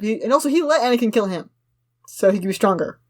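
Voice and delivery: ordinary delivery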